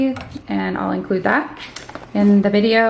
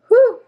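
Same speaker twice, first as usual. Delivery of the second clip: winded whew; 13 semitones higher, 6 dB louder than usual. Real, not acted. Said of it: "I'm out of breath".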